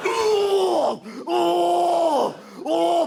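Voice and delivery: very high, loud panicked cries; terrified shrieks. Retold or imitated. Comic terrified shrieks at a spider in the face. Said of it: "I react physically".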